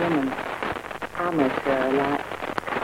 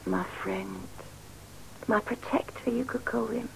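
confiding whisper about her protector; hushed aside about Fred; 4 semitones higher, 12 dB quieter than usual. Acted.

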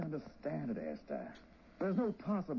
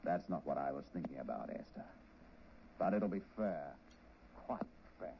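menacing; 13 semitones lower, 6 dB quieter than usual. Acted. Gangster quietly threatens her.

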